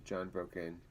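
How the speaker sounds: usual speaking voice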